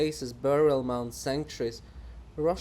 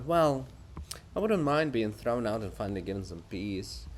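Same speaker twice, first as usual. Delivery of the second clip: ordinary delivery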